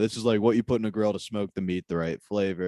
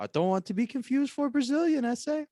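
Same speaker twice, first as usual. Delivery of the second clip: mock Mexican accent; 13 semitones higher than usual. Juan character voice.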